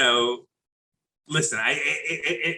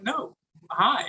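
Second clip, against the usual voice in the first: chirpy stranger; 10 semitones higher than usual. Retold or imitated. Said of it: "They're like".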